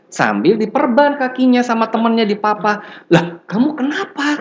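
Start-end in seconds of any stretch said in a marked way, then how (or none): 3.1-4.4 high startled "what happened to you"; surprised question. Retold or imitated. Lecturer startled at injured student, surprised. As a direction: ask in sudden high surprise. Surprised teacher quoting himself.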